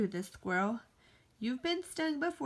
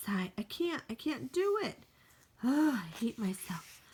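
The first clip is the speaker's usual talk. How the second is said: ordinary delivery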